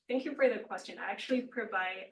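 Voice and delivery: usual speaking voice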